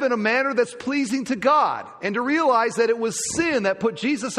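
loud, high, driving sermon delivery; preaching at full force. Acted. Pastor to congregation, preaching at full force. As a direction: preach it hard from the pulpit.

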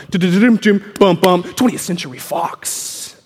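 imitated movie-studio drum roll; mock film-intro fanfare; vocal drum roll. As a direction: drum it out with the mouth, then announce slowly. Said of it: "It's a big drum roll".

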